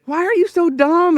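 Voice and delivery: high, loud berating of himself; self-bashing rant. Retold or imitated. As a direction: scold yourself hard for flying to the wrong town. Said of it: "And so I'm bashing myself".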